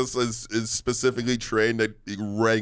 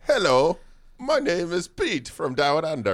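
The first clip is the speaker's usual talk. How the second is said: high-pitched mock Aussie introduction; hammed-up Australian character voice; Australian accent; 6 semitones higher than usual. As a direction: introduce yourself as a cartoon Australian. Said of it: "tell the story in an Aussie accent".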